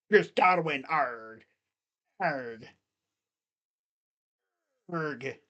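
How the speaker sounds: half-hearted pirate call, sinking low and soft; pirate announcer. Pretend. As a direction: trail into mock pirate args. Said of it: "P A announcer for the Buccaneers just talks in pirate voice".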